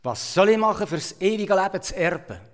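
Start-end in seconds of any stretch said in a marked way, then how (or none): none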